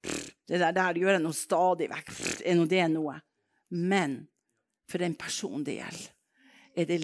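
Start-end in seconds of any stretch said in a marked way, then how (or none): 0.1-3.2 lower, scoffing voice with puffs of air; a low dismissive mutter of someone else. Retold or imitated. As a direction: brush it off as nothing, pff. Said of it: "Kanskje andre tenker".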